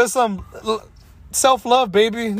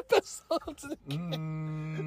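slow, softer, breaking into laughter; cracking up mid-line; 14 dB quieter, slower than usual. Real, not acted. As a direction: barely get the words out laughing. Cracking up repeating slogan.

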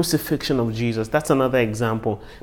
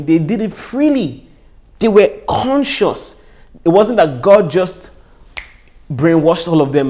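fervent preaching; 6 semitones higher, 7 dB louder than usual. Real, not acted. Preacher pressing the brothers' guilt.

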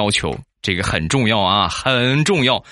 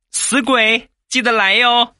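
high, flirty put-on falsetto; mock coquettish voice; 3 dB louder, slower than usual. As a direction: tease the listeners like a coy sweetheart, pitched way up. Playful flirty sign-off to listeners. (假装娇嗔的尖嗓子)

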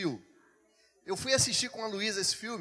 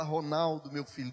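gentle first call; 6 semitones lower than usual. Retold or imitated. Holy Spirit first calling the pastor, gentle low voice. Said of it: "o Espírito Santo mudou o tom. ... Primeiro ele fala".

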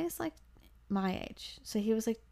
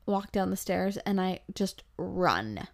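normal talking voice

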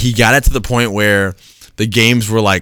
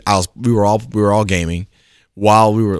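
normal talking voice

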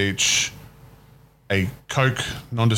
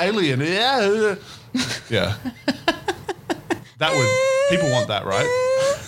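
squeaky alien voice; 10 semitones higher, slower than usual. Pretend. Riffing in an alien voice.

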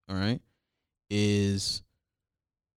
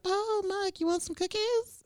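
high sugary mom voice; sweetly offering cookies, much higher. Retold or imitated. Mocking mom offering the boyfriend cookies, high sweet voice. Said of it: "she's like".

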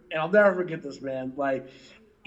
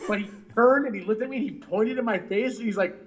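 ordinary talk

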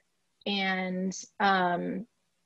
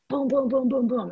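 higher, rapid-fire booms like knocks on a door; imitated pounding; 5 semitones higher than usual. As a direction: pound out each boom, raised in pitch. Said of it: "there is a loud pounding".

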